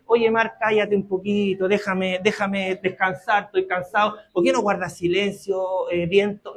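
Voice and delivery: a gentle sleepy request to the sea; soft tired plea, not a command; weary soft plea. Acted. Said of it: "No es que él se levante y le dice".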